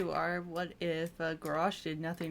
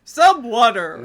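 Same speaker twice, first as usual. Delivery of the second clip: mock heroic; 4 semitones higher, 15 dB louder, slower than usual. Continuing overdramatic bit.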